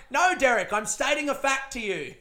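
cracking up while insisting on the fact; exasperated mum. Retold or imitated. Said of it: "Mum used to always flip her fucking shit".

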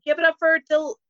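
hype announcer call. Retold or imitated. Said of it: "And I went up there and I was like".

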